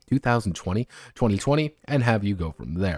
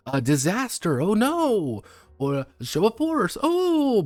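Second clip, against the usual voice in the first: high, hammed-up dismay; mock alarm; 10 semitones higher, slower than usual. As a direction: swoop way up on each exclamation. Acting out reactions to rolled prompts.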